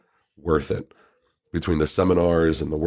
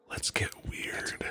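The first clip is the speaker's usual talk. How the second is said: breathy whispered let's get weird, not the usual deep voice; whispered catchphrase; 14 dB quieter, much slower than usual. Acted. Host whispers his catchphrase instead of the usual deep voice. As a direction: whisper it low and slow. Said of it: "I didn't do it in the normal deep voice".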